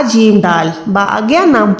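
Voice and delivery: normal talking voice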